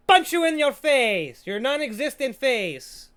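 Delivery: loud, slow, high mock-angry shout; mock threat. Threatening the faulty light, mock-angry shout. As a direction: draw out a playful threat loudly.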